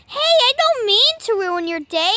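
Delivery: ordinary talk, nothing marked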